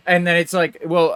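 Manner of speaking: usual speaking voice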